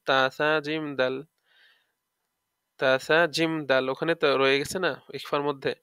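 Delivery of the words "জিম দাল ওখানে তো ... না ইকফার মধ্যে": usual speaking voice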